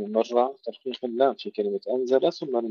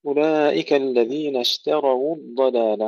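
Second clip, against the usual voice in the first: Quran recitation; 3 semitones higher, 5 dB louder than usual. Acted. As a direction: recite it like tajweed in prayer. Recites verse.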